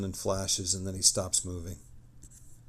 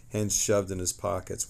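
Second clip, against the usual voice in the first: normal talking voice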